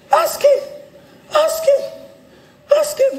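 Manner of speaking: very high, loud mock wail; agitated wife. Wife answers pastor about their sex life, high-pitched mock outburst. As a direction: squeal it out, imitating an exasperated wife. Acting wife's agitated retort.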